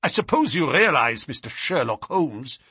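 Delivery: a touch of outraged protest; angry captive. A narrator's reading. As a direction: rebuke him with mild heat. Angry captive rebukes the detective.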